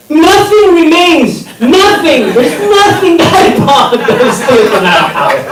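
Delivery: exasperated rant. Acted. Comedian lamenting what is left from that house, high exasperated rant. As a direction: pitch it way up and stretch out NOTHING. Comedian ranting about losing everything.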